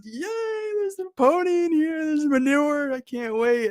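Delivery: ecstatic yay. Acted. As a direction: cheer like a little kid on his birthday. Optimist twin cheering.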